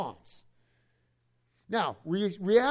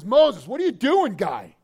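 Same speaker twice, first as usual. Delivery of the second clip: louder, higher mock gripe; complaining crowd; 3 semitones higher, 9 dB louder, slower than usual. Preacher voicing Israelites griping at Moses, loud. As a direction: heckle Moses like an irritated crowd.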